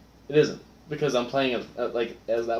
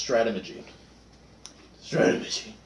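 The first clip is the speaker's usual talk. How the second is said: mumbling. Acted. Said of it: "in the front and mumbles".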